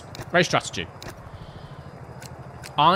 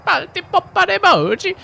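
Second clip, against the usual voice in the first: put-on high, loud, drawn-out Italian accent; 7 dB louder than usual. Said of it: "just gonna be Italian".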